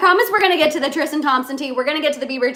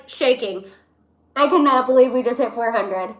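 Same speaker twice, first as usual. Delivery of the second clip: ordinary talk, nothing marked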